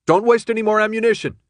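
loud, high-pitched order; yelled. A narrator's reading. Thomas ordering friends to save grenades, yelled. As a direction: yell it across the gunfire. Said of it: "he yelled".

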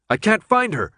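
high-pitched urgent call; shouted. A narrator's reading. Friend reporting the guard has vanished, shouted. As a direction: call it out in alarm. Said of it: "his friend shouted".

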